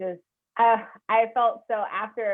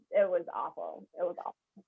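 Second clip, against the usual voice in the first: usual speaking voice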